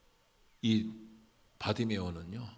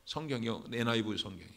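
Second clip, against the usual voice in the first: ordinary delivery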